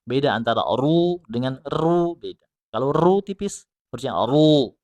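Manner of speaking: ordinary talk, nothing marked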